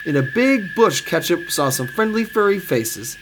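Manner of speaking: plain reading voice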